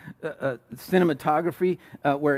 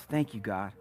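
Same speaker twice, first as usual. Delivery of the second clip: quiet thanks; 7 semitones lower, 8 dB quieter than usual. Pretend. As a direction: lower and softer, grateful.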